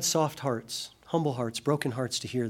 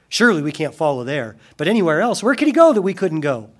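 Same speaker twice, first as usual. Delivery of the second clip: indignant, rising, louder grumble; mock the offended crowd; grumbling crowd; 5 semitones higher, 9 dB louder than usual. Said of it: "they grumble and complain".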